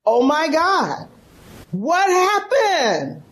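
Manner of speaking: slow, stunned disbelief; shocked and hurt. Retold or imitated. Daughter stunned by her father's outburst, slow and hurt. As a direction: let the hurt sink in on each word. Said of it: "I was like".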